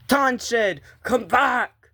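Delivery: high, carrying shout; calling out. Acted. Calling Tanchid to come back, high shout. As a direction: call after someone who left.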